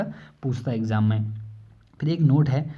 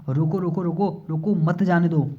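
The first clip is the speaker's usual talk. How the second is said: calling to stop thief; 3 semitones higher than usual. Acted. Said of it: "चोरी करके भाग रहा है और हम बोले".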